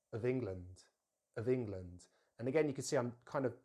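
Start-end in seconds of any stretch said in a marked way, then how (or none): 0.1-2.0 "of England" run together as "uh-vingland"; no gap between "of" and "England"; linked words. Shown as a demonstration. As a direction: join weak "of" straight onto "England". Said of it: "join it straight to the next word".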